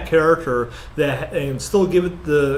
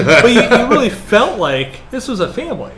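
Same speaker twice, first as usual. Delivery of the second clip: talking through a laugh, higher and louder; 8 semitones higher, 6 dB louder than usual. Real, not acted. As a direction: laugh your way through the point. Laughing while agreeing about family.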